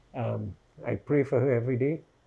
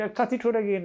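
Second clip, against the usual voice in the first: imitating attacker; 8 semitones higher, faster than usual. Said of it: "They say".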